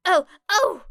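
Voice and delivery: loud, high, hand-waving eagerness; excited girl jumping in; eager exclamation. A narrator's reading. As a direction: call out like you're bursting to answer. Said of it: "exclaimed Ashido".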